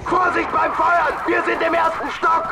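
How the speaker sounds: shouting. Acted. Soldier calling out in firefight.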